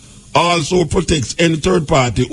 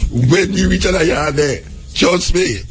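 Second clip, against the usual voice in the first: ordinary talk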